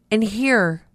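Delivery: normal talking voice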